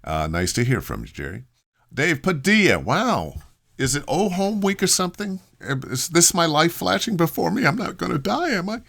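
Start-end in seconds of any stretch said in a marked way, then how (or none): 1.9-3.3 happy surprise. Real, not acted. Greeting a friend from the old studio days with glad surprise. 3.8-8.8 joking panic pitched well up; mock alarm. Joking to an old friend that his life is flashing by, in mock alarm.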